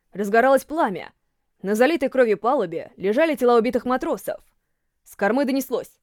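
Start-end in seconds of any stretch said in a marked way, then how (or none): none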